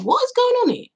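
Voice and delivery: bewildered thought. Retold or imitated. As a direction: ask it in high-pitched disbelief.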